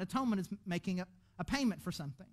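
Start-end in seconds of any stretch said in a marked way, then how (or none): none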